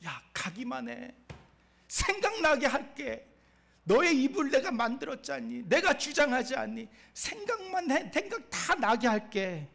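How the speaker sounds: God coaxing insistently. Acted. Preacher as God persuading Moses, high and insistent. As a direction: urge a reluctant listener along.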